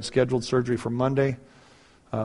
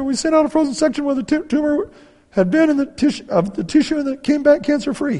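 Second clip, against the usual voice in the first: very high, imitated weepy wife; tearful wife; 14 semitones higher, 4 dB louder than usual. Wife relays surgeon's findings to husband, high mock-tearful voice. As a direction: keep relaying it in her overwhelmed high voice. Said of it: "and she starts crying".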